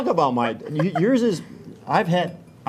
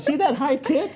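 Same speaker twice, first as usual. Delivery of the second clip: excited; 10 semitones higher than usual. Real, not acted. Excited about the kick.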